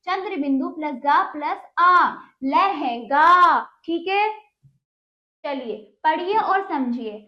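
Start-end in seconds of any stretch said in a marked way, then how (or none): 2.4-3.6 लहंगा loud and stretched, long गा; emphatic, syllable-by-syllable लहंगा with nasal हं; model pronunciation. Shown as a demonstration. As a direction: draw लहंगा out for pupils to copy. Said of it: "तो बोलिए".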